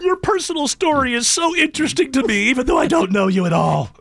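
high, mock-earnest gushing that drops at the end; mock slam poet. Host joins the slam parody, high mock-earnest poet. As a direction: play along as an overwrought poetry fan.

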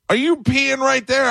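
high, slow yell; mock yell. Narrator mimicking the dad's third yell at his son. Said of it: "the third time that he yelled".

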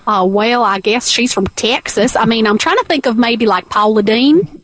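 a Paula Deen-like Texas accent. Shown as a demonstration. Co-host voices the surgery patient on request, Texas accent. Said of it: "give me her as a Texas accent".

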